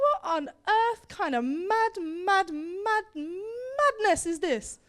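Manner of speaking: high, slow, drawn-out disbelief; incredulous outrage. Acted. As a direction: stretch each "mad" in mock amazement. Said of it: "And she's like".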